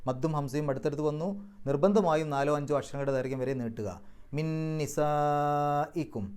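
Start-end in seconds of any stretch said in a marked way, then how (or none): 4.4-6.2 slow chanted മിൻ നിസായിക്കും with the doubled nasal നൂൻ held; Quran recitation. Shown as a demonstration. Teacher shows the merged doubled noon, slow chanted recitation. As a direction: stretch the ന്ന് in മിൻ നിസായിക്കും. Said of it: "ഷദ്ദുള്ള നൂനാക്കിയിട്ട് പറയാം".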